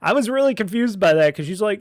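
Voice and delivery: normal talking voice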